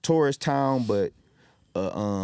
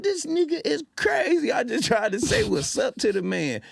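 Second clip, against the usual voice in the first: much higher, amazed; incredulous high exclaim; 12 semitones higher than usual. Retold or imitated. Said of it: "I said".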